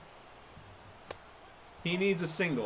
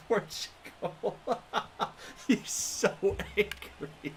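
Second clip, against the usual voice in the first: breathless whispered giggle through the words; laughing; 4 semitones higher, 5 dB quieter, slower than usual. Real, not acted. Gamer giggles at the angry pitcher, laughing whisper. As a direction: laugh it out under your breath.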